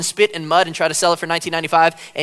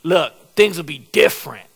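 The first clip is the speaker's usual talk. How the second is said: barbershop talk; 4 dB louder than usual. Retold or imitated. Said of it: "people will be like".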